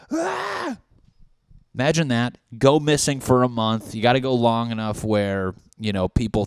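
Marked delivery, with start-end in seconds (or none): 0.1-0.8 high-pitched comic scream; mock shriek. People shocked he is alive, mock scream. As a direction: squeal in pretend shock.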